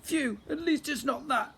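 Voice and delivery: high, slow relieved sigh of a prince; relieved goblin prince. Acted. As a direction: breathe out in relief, squeaky. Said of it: "Grogber said".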